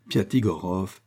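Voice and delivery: plain reading voice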